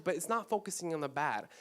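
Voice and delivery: usual speaking voice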